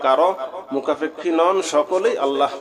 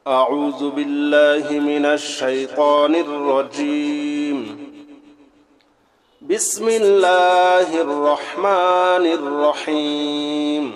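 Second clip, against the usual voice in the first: slow, drawn-out chanted recitation; slow Quran recitation; much slower than usual. Shown as a demonstration. Teacher recites the opening slowly for students, chanted. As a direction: intone each word slowly and reverently.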